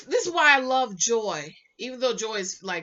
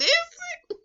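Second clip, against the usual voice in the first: squeaky high doubtful tag question; high, mock-doubting tag question; incredulous; 3 dB louder than usual. Real, not acted. Doubting a viewer's quote, high and incredulous.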